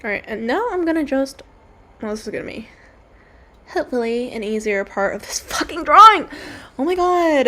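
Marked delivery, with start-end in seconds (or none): 5.5-7.5 higher, louder mock exclamation; mock irritating voice. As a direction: play up a grating, over-the-top voice. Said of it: "that irritating voice".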